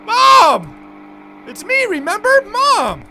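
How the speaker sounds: high, loud desperate calls to mother; desperate calling. Acted. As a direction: cry out to make her remember.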